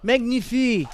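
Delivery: a playful French flourish on Magnifique; mock French accent. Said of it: "cultural bloody stereotypes".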